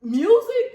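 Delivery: music shouted high and loud; stress on music. Real, not acted. Mother hammering home that music matters, loud high stress on music. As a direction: punch the word music hard. Emphatic point about music.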